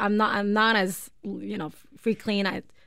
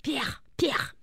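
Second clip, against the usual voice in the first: mock French accent; 5 semitones higher, 6 dB quieter than usual. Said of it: "French accent".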